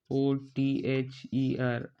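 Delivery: slow letter-by-letter spelling; measured classroom spelling, one letter at a time. Shown as a demonstration. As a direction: spell it slowly, each letter held apart. Teacher spells the word letter by letter.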